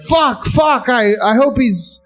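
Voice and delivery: a shaken gazelle half-caring; mock panic. Gazelles fleeing again.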